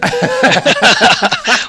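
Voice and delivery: high burst of laughter; laughing. Real, not acted. Laughs at host's teasing.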